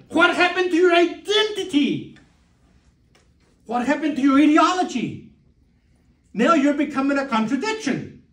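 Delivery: high-pitched acted reproach; retold scolding. Girlfriend confronting him over his lost identity, scolding. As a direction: imitate her taking you to task.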